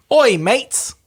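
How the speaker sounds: a cheery Australian greeting; Australian accent. Shown as a demonstration. Australian accent reading a listener's message. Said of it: "you said it in your Australian accent".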